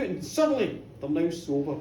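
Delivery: usual speaking voice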